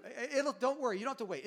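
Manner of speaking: ordinary delivery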